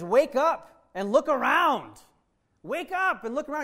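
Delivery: Jesus urging the disciples to wake up, loud; urgent wake-up. Acted. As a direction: rouse the sleepers. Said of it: "He says".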